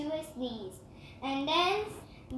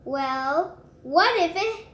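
plain reading voice

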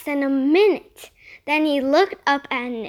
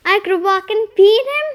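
eager; 5 semitones higher, 7 dB louder, faster than usual. A narrator's reading. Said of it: "Nick asked".

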